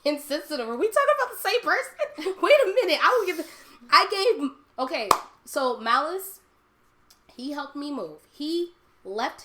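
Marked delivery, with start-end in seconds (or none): 0.0-4.5 high, loud disbelief through laughter; playfully indignant "who, me?" outburst; mock incredulity. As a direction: act affronted, pitch leaping up. Acting out disbelief at being called insensitive.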